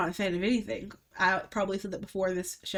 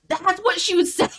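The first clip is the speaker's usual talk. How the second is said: laughing quote; 10 semitones higher, 6 dB louder than usual. Retold or imitated. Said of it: "He's like".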